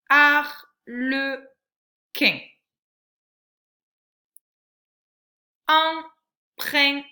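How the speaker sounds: each word loud and drawn out; teacher sounding out picture words; loud word reading. Shown as a demonstration. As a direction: articulate it slowly for a child. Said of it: "en te donnant en fait pour chaque image".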